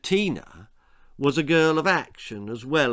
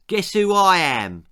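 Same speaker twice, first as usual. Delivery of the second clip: mocking impression; 6 semitones higher, 6 dB louder than usual. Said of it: "puffing out his cheeks".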